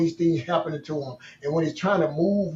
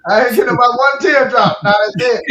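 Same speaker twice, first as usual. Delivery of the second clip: retold loud, higher shout from a dad; dad's stern shout; 4 semitones higher, 9 dB louder than usual. Dad ordering his crying son to stop, loud shout. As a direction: bark it like a dad ending the crying.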